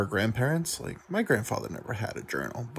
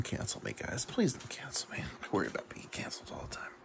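soft, breathy whisper begging the viewers; whispered plea; 8 dB quieter than usual. Real, not acted. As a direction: hush it like a guilty secret. Joking plea not to be cancelled.

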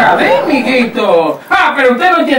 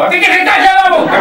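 mock bossy order; 8 semitones higher, much faster than usual. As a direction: bark the order like a petty tyrant. Patrón ordena callar. (orden fingida de patrón, rápida y más aguda)

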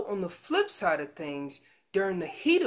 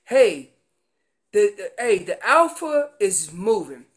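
loud call; 7 dB louder than usual. Retold or imitated. Herd calling that alpha moves.

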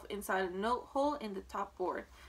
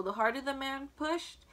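usual speaking voice

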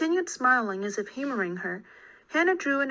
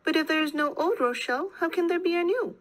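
high-pitched wondering aloud; musing out loud; 6 semitones higher than usual. A narrator's reading. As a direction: puzzle it out in a raised pitch. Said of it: "Schmoll mused out loud".

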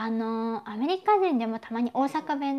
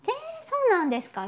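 surprised; 13 semitones higher, much faster than usual. Real, not acted. Reacting to listener's cruise news.

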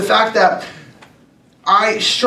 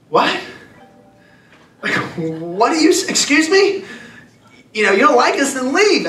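higher, incredulous what-did-you-just-say tone; indignant disbelief; 7 semitones higher than usual. Retold or imitated. Shopper reacting to the old man insulting Americans, indignant and incredulous. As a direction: push back in shocked offense. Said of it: "And I was just like".

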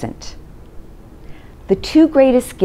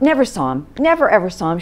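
ordinary delivery

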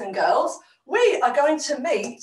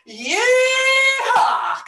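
high, held cowboy holler; Cowboy Cal; 10 semitones higher than usual. Pretend. Host showing the cowboy character, hollering high. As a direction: whoop it long and high, then drop. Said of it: "we've got Cowboy Cal".

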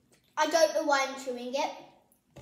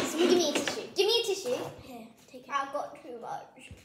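ordinary delivery

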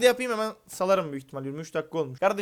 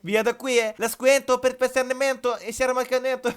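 mock Italian patter, pitched higher; fake Italian gibberish; 5 semitones higher than usual. Mock-Italian babble to the aunt during the robbery. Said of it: "Oğlum nece konuşuyorsun lan sen".

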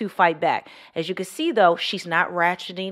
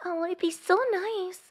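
put-on young girlish voice; 11 semitones higher, 5 dB quieter than usual. Shown as a demonstration. Demo for viewers, coy young girl voice. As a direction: play a bashful young girl. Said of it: "I can speak like that".

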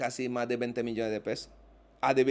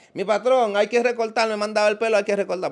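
higher, louder pleading voice of a buddy asking a favour; pleading friend; 8 semitones higher, 7 dB louder than usual. Retold or imitated. Mocking a friend who begs him for a haircut, high pleading voice. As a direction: beg your boss for a haircut, half-mocking.

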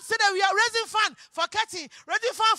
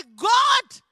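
calling out to God; 3 semitones higher, 9 dB louder than usual. Acted. Preacher showing believers how to call on God, loud cry. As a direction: call out 'God' with full force.

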